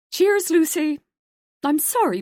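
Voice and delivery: high and loud across the stalls; calling out. A narrator's reading. Joan calling back to Lucy over the cubicle, loud and high. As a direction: call it back over the wall.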